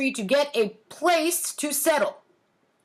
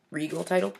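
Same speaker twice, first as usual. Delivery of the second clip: a quiet, doubtful echo of her own phrase; incredulous aside; 5 semitones lower, 4 dB quieter, faster than usual. Real, not acted. Mocking her childhood essay, quietly disbelieving. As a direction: question the grand words softly. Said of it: "mocking her own essay".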